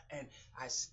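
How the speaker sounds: usual speaking voice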